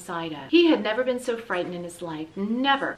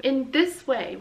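ordinary delivery, nothing marked

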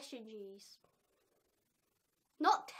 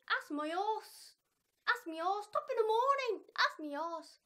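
Irish accent attempt; 6 semitones higher, 6 dB louder than usual. She tries the Irish accent she can't get, hammed up. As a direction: ham up an Irish accent, pitched up. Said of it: "I can't get that accent".

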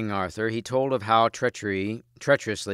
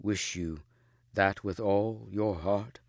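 slow, a touch strained, held back; lightly strained lover's plea; slower than usual. A narrator's reading. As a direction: force the words out gently against emotion. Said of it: "in a strained voice".

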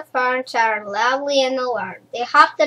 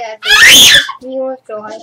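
bursts out laughing, much louder and slower; joyful laughing shout; 11 dB louder, slower than usual. Acted. Reader cheering the happy ending with a loud laughing shout. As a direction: let the joy explode into a laughing shout.

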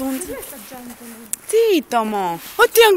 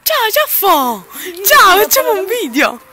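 loud greeting; 6 semitones higher, 7 dB louder than usual. Real, not acted. Greeting for the video.

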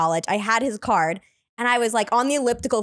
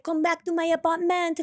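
put-on French accent; 5 semitones higher than usual. French guy invites her back mid make-out, French accent. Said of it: "I met this French guy".

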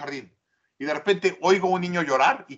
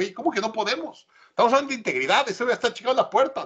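higher, quick protest; retold incredulous challenge; 7 semitones higher, faster than usual. Consultant challenging an employee about integrity, retold incredulous protest. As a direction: push back in disbelief, rattling it off.

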